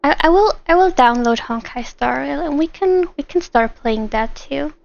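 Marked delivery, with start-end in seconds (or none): none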